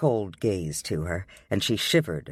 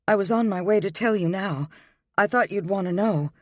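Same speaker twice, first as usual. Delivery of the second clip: put-on higher, earnest young woman pleading; 8 semitones higher than usual.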